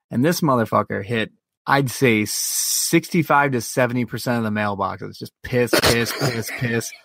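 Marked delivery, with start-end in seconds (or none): none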